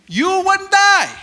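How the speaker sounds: high, loud, slow sly serpent. Retold or imitated. Serpent tempting the woman, slow and loud high voice. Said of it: "the serpent replied to the woman".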